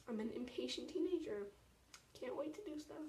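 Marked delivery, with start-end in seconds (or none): none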